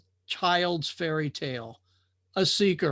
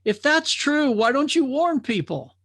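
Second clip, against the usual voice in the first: alarmed drifter; 9 semitones higher, 5 dB louder than usual. Acted. Drifter challenges the watcher.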